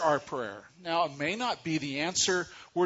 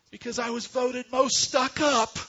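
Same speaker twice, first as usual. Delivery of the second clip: put-on higher, louder child's wail; sulky little girl; 8 semitones higher, 5 dB louder than usual. Daughter telling mom why she cries, mock upset. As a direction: blurt it out like a hurt schoolgirl. Girl's punchline.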